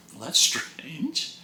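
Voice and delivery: slow whisper to yourself; puzzled whisper. Retold or imitated. Husband finding the kitchen tap dry, slow whisper. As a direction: breathe it out, baffled.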